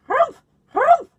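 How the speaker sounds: very high, loud, rising huffs; a cross little bird huffing three times; bird's angry humph. Acted. Bonga bird huffing at the squirrels, high and loud. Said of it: "yelled the bonga bird".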